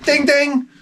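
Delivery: high, sung ding-ding like a quiz-show bell; high mock ding ding. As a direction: ring it out like a prize bell. Mock game-show bell for moron.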